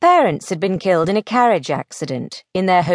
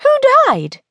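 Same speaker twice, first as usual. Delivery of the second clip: high, louder, blunt; bald, blunt question; 5 dB louder than usual. Acted. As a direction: ask it baldly, pitched right up. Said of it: "Belle asked baldly".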